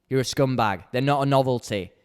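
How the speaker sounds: usual speaking voice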